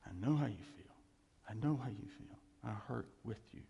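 low, gentle answer to someone hurting; quiet, compassionate voice; tender reassurance. Acted. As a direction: console softly, sharing the hurt. Voices God answering a hurting person.

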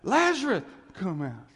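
high, loud shout on the name, then dropping; loud call. Acted. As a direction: call out one specific man. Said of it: "cried in a loud voice".

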